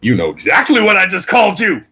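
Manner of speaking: loud, high-pitched indignant outburst; indignant retort. Real, not acted. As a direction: fire back loudly at a challenge. Mock quarrel after an insult.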